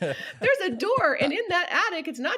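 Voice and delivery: laughing through the words, pitched high. Real, not acted. Widow laughing at the hidden breaker box, laughing high. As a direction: tell it while cracking up. Laughing at her own story.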